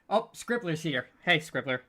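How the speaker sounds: normal talking voice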